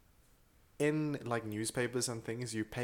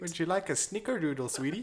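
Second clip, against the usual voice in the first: higher-pitched mock Minnesota accent; sweet Minnesota accent; 6 semitones higher than usual. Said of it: "And it's very sweet".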